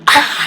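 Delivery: short loud mock scream; a kid caught on the toilet, screaming; retold scream. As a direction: yell out like a startled kid.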